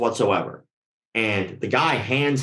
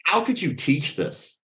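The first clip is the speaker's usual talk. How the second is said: condescending; 4 semitones higher, slower than usual. Retold or imitated. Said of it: "it was very condescending".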